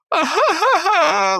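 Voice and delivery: very high cartoon giggle; put-on silly high-pitched cackling; imitated giggling laugh. Pops giggling at his reflection in the fountain, high mock laugh. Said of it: "he's all giggling and laughing".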